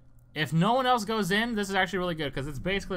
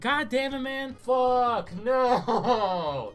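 frustrated; 7 semitones higher, 4 dB louder than usual. Real, not acted. Frustrated at bad knife.

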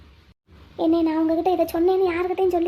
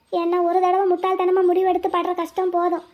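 normal talking voice

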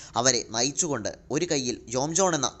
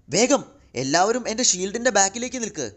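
high-pitched, raised-voice warning; urgent call; 8 semitones higher, 3 dB louder than usual. A narrator's reading. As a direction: call the team to cover. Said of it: "Spidey ഉറക്കെ പറഞ്ഞു". (ഉയർന്ന സ്വരത്തിൽ ഉറക്കെ വിളിച്ച്)

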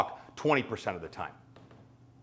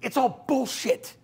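stern coach; 11 semitones higher than usual. Retold or imitated. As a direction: shush and dismiss him. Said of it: "she goes".

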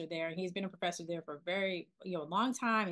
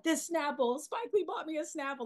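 thrilled; 8 semitones higher than usual. Retold or imitated. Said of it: "I was just so thrilled".

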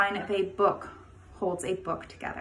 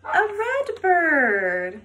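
excited reveal; 7 semitones higher, 7 dB louder, much slower than usual. Acted. Answers own question to kids.

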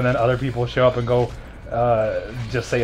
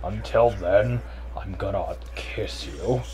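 low vampire voice; 8 semitones lower, 7 dB quieter, slower than usual. Pretend. Vampire roleplay line.

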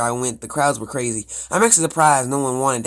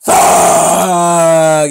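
long, loud, higher-pitched yell; alarmed shout; 4 semitones higher, 11 dB louder than usual. Pretend. Player reacting as the taxi gets slammed from behind, alarmed shout. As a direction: bellow it, drawn right out. Car is rammed in the story.

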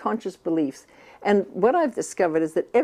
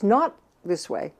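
normal talking voice

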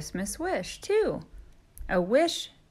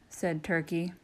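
plain reading voice

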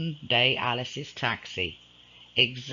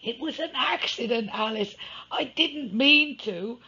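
he cried; 11 semitones higher than usual. Acted. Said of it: "he cried".

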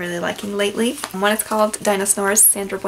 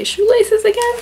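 high, louder squawky birds, fed up; exasperated birds; 13 semitones higher, 6 dB louder than usual. Acted. Pigeons seeing the bear's laces again, high and exasperated. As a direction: pitch it way up in disbelief. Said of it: "the birds come and say".

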